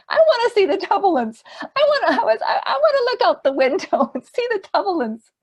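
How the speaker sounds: very high, loud and giggly; playful childlike eagerness, breaking into laughter; excited curiosity. Retold or imitated. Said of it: "that kind of excited curiosity".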